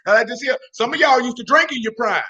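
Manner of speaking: ordinary delivery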